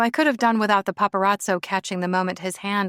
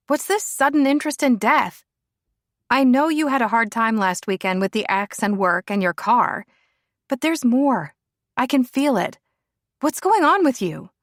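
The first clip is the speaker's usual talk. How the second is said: higher, louder worried friend; 5 semitones higher, 4 dB louder than usual. Acted. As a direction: plead out of concern. Rebecca worried about Lila.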